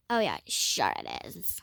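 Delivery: put-on wife voice. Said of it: "I just like using that voice".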